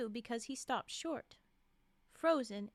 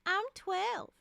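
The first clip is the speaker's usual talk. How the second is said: put-on high, loud little-girl voice; twelve-year-old girl; 7 semitones higher, 6 dB louder than usual. A narrator's reading. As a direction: announce it like a proud kid.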